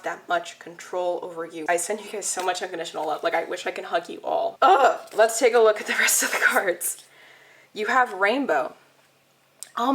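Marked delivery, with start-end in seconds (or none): 4.6-5.0 loud, high overwhelmed "ugh"; overwhelmed groan. Real, not acted. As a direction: let out a loud emotional groan. Overwhelmed by a moving card.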